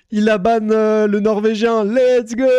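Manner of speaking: loud, high triumphant call; gleeful shout. Real, not acted. Streamer seeing his bot ban the Norwegian, gleeful shouting. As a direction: shout it out in celebration. Celebrates bot banning a viewer.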